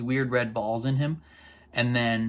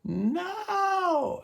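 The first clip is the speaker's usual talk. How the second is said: much higher, drawn-out plaintive no; imitating her own tearful reaction, high; heartbroken cry; much slower than usual. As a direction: plead with him as he fades away. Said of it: "I was just like".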